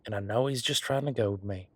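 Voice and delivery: normal talking voice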